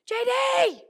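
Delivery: high, loud, stretched shout; yelling out the window. Retold or imitated. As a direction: holler to catch someone's attention. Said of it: "Lily yells out the window".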